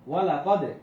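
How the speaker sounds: ওয়ালা কদে said loud with the d bounced; qalqalah echo. Shown as a demonstration. Teacher demonstrating the qalqalah rule, ওয়ালা কদে with echoed d. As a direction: release the d of কদে with a short rebound. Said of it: "কলকলা করিয়ে পড়তে হবে".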